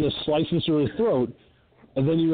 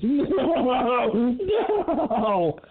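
laughing no; 8 semitones higher than usual. Real, not acted. Laughing at the scene.

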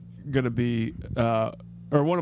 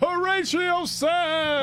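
announcer call-out; much slower than usual. Pretend. Calling out a cast name.

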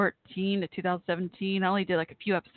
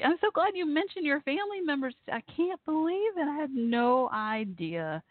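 excited; 7 semitones higher than usual. Real, not acted. Learning listener's family link.

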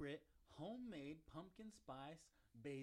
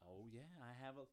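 normal talking voice

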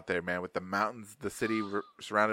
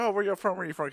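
higher, eager asking voice; curious gamer voice; 9 semitones higher, faster than usual. Retold or imitated. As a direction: pitch it up like an excited stranger online. Gamers asking where he's from.

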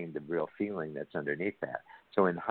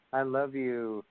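higher-pitched, drawn-out heartfelt declaration; like meaning it; 7 semitones higher, slower than usual. Acted. Husband's last try to convince partner, heartfelt. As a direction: say it as if you mean it. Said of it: "just say it like you mean it".

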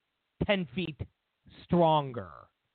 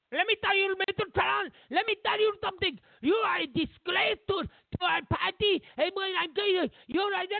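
much higher mock Spanish accent; Ana Navarro accent; 13 semitones higher than usual. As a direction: mimic her Spanish accent way up high and fast, hammed-up. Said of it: "with that horrible, horrible, horrible accent".

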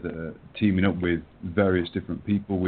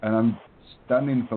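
normal talking voice

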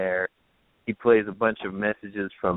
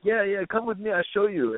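put-on higher Jamaican accent, beckoning; 10 semitones higher, 3 dB quieter than usual. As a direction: Jamaican guy leads him to the bathroom, Jamaican accent. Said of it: "there's this Jamaican guy there".

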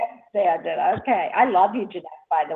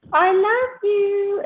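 mock sweet; 12 semitones higher, 3 dB louder, much slower than usual. Mock sentimental line in aside.

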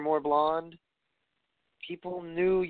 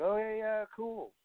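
mock bored fan; 8 semitones higher than usual. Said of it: "the fans are gonna be like".

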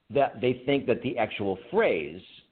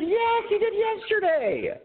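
very high, slow mock exasperation; drawn-out, squeaked-up incredulous reply; retold exasperated answer; slower than usual. Podcasters answering a forgetful co-host, retold in high slow exasperation. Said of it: "And we said".

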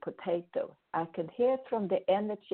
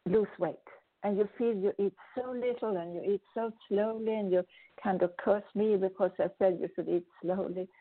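normal talking voice

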